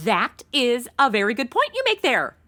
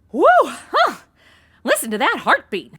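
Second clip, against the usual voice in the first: normal talking voice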